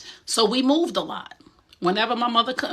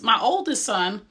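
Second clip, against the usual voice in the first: ordinary delivery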